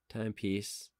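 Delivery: usual speaking voice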